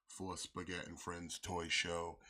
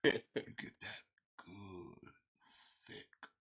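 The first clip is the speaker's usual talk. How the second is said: sexy whisper; slower than usual. Pretend. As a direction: savor each word breathily. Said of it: "talking in a sexy voice".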